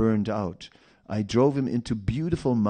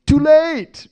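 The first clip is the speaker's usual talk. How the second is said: much higher and louder cry; mock wail; 14 dB louder than usual. Devotee realising temptation came too late, loud high wail. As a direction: wail it in hammed-up despair.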